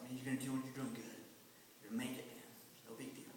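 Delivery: soft, gentle encouragement close to his ear; calm him down quietly, man to man; reassuring murmur. Retold or imitated. Youth teacher to scared boy in MRI, soft and reassuring.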